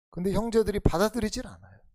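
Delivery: ordinary talk, nothing marked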